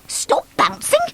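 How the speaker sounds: high, wondering question; puzzled Bounce. Acted. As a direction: repeat it, baffled.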